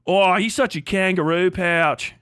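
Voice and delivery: goofy hammed-up Aussie insult; exaggerated Australian impression; mock Australian accent. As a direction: do a silly Australian putting someone down. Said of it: "goofy shit from wherever the fuck you're from".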